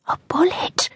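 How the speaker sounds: high, whispered disbelief; horrified whispered echo; frightened whisper. A narrator's reading. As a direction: breathe it as a scared question. Terrified girl echoes the threat.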